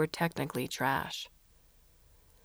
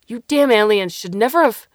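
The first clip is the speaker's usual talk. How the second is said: furious louder shout; screaming; 5 semitones higher, 13 dB louder than usual. Acted. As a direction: yell it at the alien in rage. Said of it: "he screamed".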